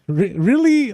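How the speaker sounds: high, disbelieving "really?"; surprised. Real, not acted. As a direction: jump up in pitch, caught off guard. Surprised reaction to seller story.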